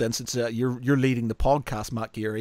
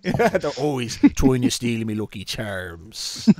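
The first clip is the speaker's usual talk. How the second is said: slow, hammed-up Irish accent; 3 semitones lower than usual. Host completes the advert riff, slow Irish accent. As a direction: drag it out in stage Irish. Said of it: "in a really thick Irish accent".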